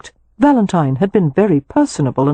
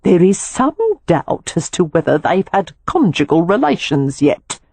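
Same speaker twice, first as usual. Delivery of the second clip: usual speaking voice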